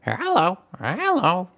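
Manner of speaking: high squawky parrot. Acted. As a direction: chirp it like a pet parrot. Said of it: "the parrot voice".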